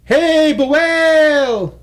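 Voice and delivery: high, slow, drawn-out loud call. Acted. Barabi calling the whale from the ocean side, long loud call. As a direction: call long across the water.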